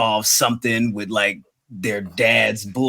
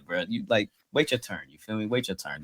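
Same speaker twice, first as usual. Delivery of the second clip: normal talking voice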